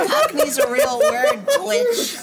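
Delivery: laughing through the words; can barely get it out for laughing. Real, not acted.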